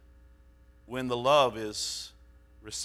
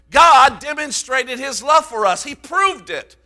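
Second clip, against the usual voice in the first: high, loud preaching; fiery preaching; 11 semitones higher, 14 dB louder than usual. Acted. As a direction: proclaim it from the pulpit. Preacher at full force.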